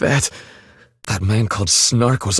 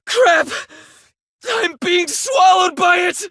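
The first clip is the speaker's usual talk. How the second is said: gasp, then a much higher terrified shout; panicked scream. Acted. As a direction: cry out as darkness swallows you. Darkness engulfing him.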